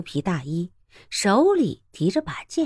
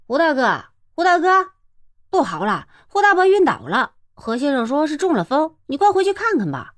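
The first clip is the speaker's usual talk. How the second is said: urgent call from a distance, higher; calling from afar; 7 semitones higher than usual. Acted. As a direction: shout the news through the fog. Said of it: "远远地叫道". (远远地急切呼喊)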